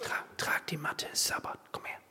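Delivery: a hushed, conspiratorial order; whispering Jesus's command to the healed man; whispered command. Acted. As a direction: whisper it, soft.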